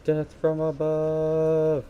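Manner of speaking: slow, high, drawn-out hammed-up war cry; theatrical slow-motion battle cry; dramatic battle cry. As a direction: ham it up like a movie hero leaping down. Retries the dive attack.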